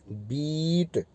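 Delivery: बीट with a long, drawn-out ee; long ee sound. Shown as a demonstration. Teacher to students, demoing the long ee vowel in बीट. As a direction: stretch the ee in बीट slowly and softly. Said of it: "अब मैं पहले ग्यारह वाली बोल रहा हूँ".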